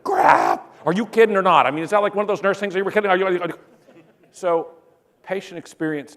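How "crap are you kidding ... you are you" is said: loud, high mock panic. Patient panicking at nurse's joke, loud high mock panic. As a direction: shout it like you just heard bad news.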